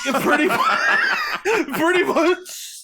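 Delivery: high slow laughing yelp. Real, not acted. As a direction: burst out laughing mid-word. Laughing agreement.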